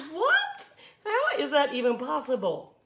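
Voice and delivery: very high squeaky what, then incredulous; disbelieving what. Retold or imitated. Telling viewers her reaction, a high squeal of disbelief.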